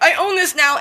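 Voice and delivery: high, loud claim of ownership; lightly voiced bossy cat. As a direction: speak up for the cat, pitched high. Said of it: "ran into the house like".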